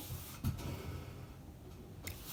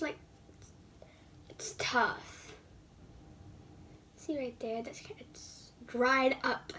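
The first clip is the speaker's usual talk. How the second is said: unvoiced whisper to the camera; soft secretive whisper; 3 semitones lower, 9 dB quieter than usual. Real, not acted. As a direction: breathe the words, no voice.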